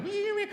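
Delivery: very high imitated amp feedback whine; guitar feedback squeal. Shown as a demonstration. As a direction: squeal like an electric guitar feeding back. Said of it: "an amazing feedback going".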